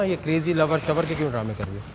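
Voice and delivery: normal talking voice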